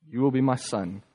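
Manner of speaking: normal talking voice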